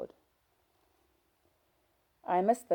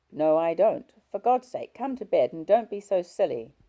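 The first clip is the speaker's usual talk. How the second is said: angry husband; 4 semitones higher, 11 dB louder than usual. Acted.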